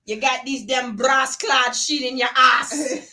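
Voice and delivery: put-upon Caribbean nurse; comic Caribbean carer doing chores; Caribbean caregiver accent. Acted. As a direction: grumble at the patient in a Caribbean accent. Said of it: "permission to use this accent".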